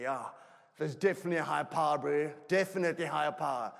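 loud, high, fast tipsy bro voice; drunk guy. Retold or imitated. Drunk guy at whiskey table.

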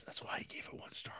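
unvoiced whispered aside; whispering. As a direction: whisper it like a secret. Aside in review.

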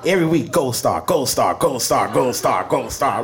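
ordinary talk

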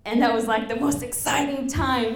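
ordinary talk, nothing marked

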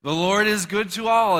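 preacher shouting it out for the crowd to echo; proclaimed shout. Shown as a demonstration. Preacher to congregation, shouting the verse loudly. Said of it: "Everyone say".

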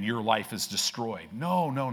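normal talking voice

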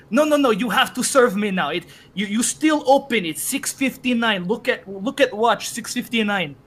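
imitated demanding shopper; insistent customer. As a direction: argue insistently as the late customer.

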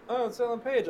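a hint of a bemused politician; mock Mike Pence. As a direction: imitate a mildly puzzled Pence. Voicing Mike Pence watching TV.

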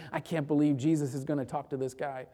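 low, quiet mock grumble; grumbling. Disciples walking the beggar over to Jesus, grouchy grumbling. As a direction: grumble it lightly under the preacher's telling. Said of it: "they were probably grouchy and grumbling".

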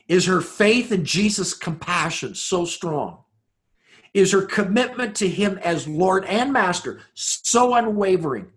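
rising preacher cadence, building; preaching. Acted. Preacher to congregation, building rhetorical questions. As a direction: build each question toward the climax.

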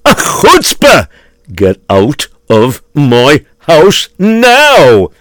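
rising furious outburst; shaking with anger. Acted. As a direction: build from indignant to shouting him out. Host turns on beggar.

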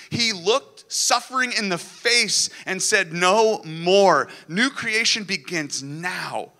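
rousing Easter sermon, full force; impassioned preaching. Acted. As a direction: build the sermon to a climax.

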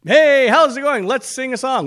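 hammed-up chirpy hello; mock cheerful greeting. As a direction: mimic a shallow cheery churchgoer. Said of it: "It's like".

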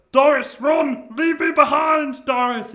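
hammed-up heroic shout. Trapped Bean telling Doris to run, hammed-up shout. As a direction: sacrifice yourself, yelling.

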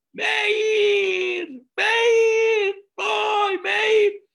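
panicked mother screaming her son's name, very high and loud; a mother sees her hurt son and screams his name in panic; frantic scream. Retold or imitated. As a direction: scream for your child in terror. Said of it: "¿qué empezó a gritar?".

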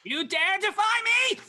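high, loud menacing demand; booming demon lord. Acted. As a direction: threaten them from on high. Xanathar threatens party.

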